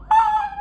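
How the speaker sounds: very high, loud shriek of surprise; startled scream. Retold or imitated. Realizing Puss in Boots is the assassin, a startled shriek. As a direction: shriek as the twist hits. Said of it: "I was like".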